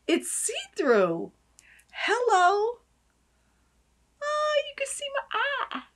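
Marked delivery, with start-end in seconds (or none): 1.9-5.8 high, amused discovery; high playful delight. Real, not acted. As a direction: squeal it with glee. Peering through bottle.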